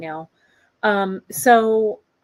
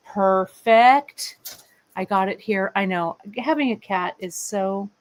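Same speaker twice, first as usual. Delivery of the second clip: normal talking voice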